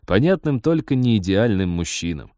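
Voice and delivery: plain reading voice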